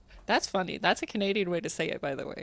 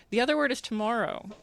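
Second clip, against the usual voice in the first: normal talking voice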